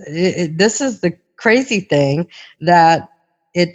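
ordinary talk, nothing marked

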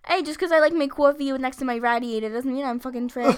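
loud, hammed-up Long Island indignation; Long Island accent. Long Islander defending the accent, loud mock-offended Long Island rant. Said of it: "Boston and Long Island".

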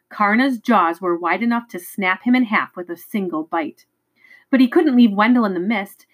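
plain reading voice

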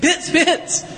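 "Pits, pits" punched high, word by word; emphasis by repetition. Shown as a demonstration. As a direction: hit pits twice, each one stressed. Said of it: "say it with, you know, multiple times".

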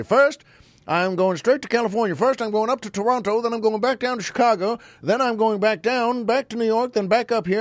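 high, brisk blustering senator; Senator Claghorn. Retold or imitated. Imitating a senator describing his giant route, high and blustering. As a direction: rattle off the route like a radio blowhard. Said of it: "He'd say".

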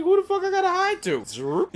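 high-pitched retold protest; high imitation of an offended hero; mock indignant hero. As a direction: squeal it as a put-on complaint. Mimicking hero's indignant question.

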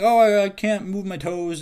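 high, loud mock whine; hammed-up helpless whine; mock whiny client. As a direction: send up the helpless complainer. Mocking a complaining client.

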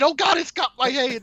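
high, squealing panic; panicked. Retold or imitated. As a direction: yelp as it grabs you. Imitated player being grabbed.

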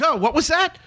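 mock innocent question. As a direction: pretend you didn't hear him, lightly. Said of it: "And no, actually, I don't think I said".

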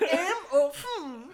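put-on slow, high posh English accent; mock English waiter accent. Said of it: "Oh yeah, English".

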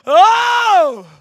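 loud high startled "Oh!"; retold shocked cry; shocked gasp. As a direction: recoil at the sight of blood. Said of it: "I'm like".

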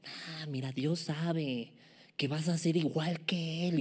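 very soft, sly persuasion; tempting serpent. Acted. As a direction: coax in a hushed, confiding voice. Serpiente persuade a Eva. (serpiente persuasiva, casi susurrando)

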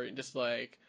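ordinary talk, nothing marked

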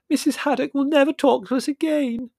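high, loud, sobby lament; tearful wailing. A narrator's reading. Margot lamenting the lost medium, tearful wailing. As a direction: bewail it at the top of the voice. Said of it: "burst into floods of tears".

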